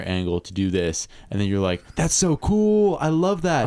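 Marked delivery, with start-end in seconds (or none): 1.9-3.7 gushing, drawn-out amazement; high and slow, totally wowed; excited. Retold or imitated. Imitating an impressed movie viewer.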